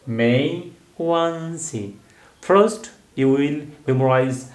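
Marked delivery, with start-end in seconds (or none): 0.1-2.0 "没关系" drawn out slowly, each tone clear; slow tone demo. Shown as a demonstration. Teacher models 没关系 slowly after naming its tones. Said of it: "first tone".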